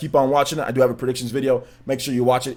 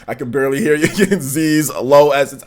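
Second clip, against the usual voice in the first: ordinary talk, nothing marked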